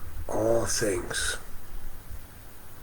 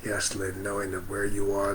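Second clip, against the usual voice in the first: ordinary talk, nothing marked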